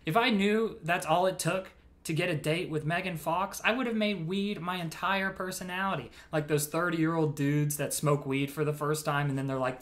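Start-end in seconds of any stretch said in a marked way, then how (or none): none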